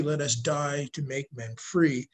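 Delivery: ordinary talk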